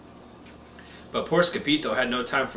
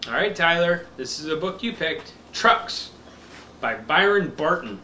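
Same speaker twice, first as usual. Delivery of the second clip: slow, bright picture-book reading; reading to a toddler; 2 semitones higher, 3 dB louder than usual. Acted. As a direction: read it slow for a little one.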